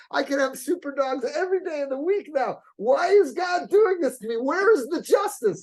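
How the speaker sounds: rising to a high, loud cry of despair; a man crying out why me, building to a shout; anguished outcry. Retold or imitated. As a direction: plead with God, voice climbing to a shout. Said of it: "he's screaming".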